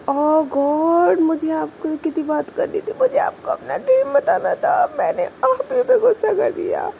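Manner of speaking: high, distressed muttering to herself, full of regret; regretful self-talk. Acted. Girl on scooter regrets snapping at girlfriend, high fretful self-talk. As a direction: scold yourself in a high fretful voice. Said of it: "इस तरीके से अपने आप से बोलती है".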